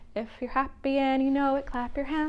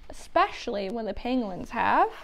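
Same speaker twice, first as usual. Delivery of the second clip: usual speaking voice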